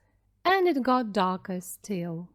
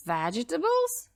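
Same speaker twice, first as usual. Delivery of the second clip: higher, shocked wolf at vegetables; wolf hearing about vegetable soup, disgusted cry; 5 semitones higher than usual. A narrator's reading. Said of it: "cried the wolf".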